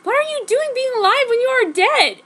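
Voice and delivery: high, loud shocked cry; shocked disbelief. Acted. Jenny crying out at the dead man alive, high and shocked. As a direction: blurt it in total shock.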